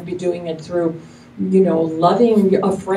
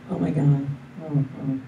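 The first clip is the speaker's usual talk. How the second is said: lower, softer pitying moan; mock pity; 4 semitones lower, 5 dB quieter than usual. Shown as a demonstration. Showing the wrong way to comfort, pitying moan. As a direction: wallow along in mock sympathy. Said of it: "you see the difference?".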